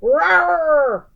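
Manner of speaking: big loud roar; bear roar. A narrator's reading. Bear roaring at Baba Brena, loud. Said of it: "Old Bear roared".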